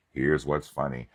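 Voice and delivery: ordinary talk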